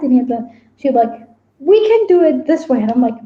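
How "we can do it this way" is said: higher-pitched, lightly voiced suggestion; mock persuading. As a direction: coax like a character arguing her case. Voices character proposing in argument.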